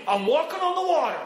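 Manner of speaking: high-pitched, exultant cry; triumphant boast. Acted. Believer full of faith one minute, elated. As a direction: crow it proudly, pitched high. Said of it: "One minute we're saying".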